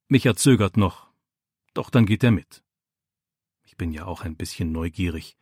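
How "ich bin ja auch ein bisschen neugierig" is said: leise vor sich hin gemurmelt. A narrator's reading. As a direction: halblaut und etwas verlegen zugeben. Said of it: "murmelt er". (leises Murmeln)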